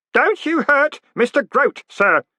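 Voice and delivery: high, slow, ringing warning; guard the old man, earnest; odd boy protecting Groat; ringing boy's voice. Acted. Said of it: "said a ringing voice".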